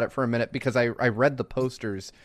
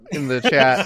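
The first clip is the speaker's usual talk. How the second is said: normal talking voice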